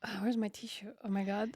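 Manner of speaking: real tearful whisper to herself; teary whisper. Real, not acted. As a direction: whisper it while wiping your eyes. Crying, searching for a tissue.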